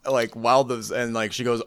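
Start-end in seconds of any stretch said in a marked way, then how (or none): none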